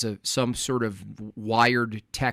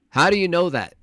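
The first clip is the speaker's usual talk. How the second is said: "do" punched, louder; stress on do; 5 semitones higher, 4 dB louder than usual. Shown as a demonstration. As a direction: lean hard on "do". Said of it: "I love asking simple questions".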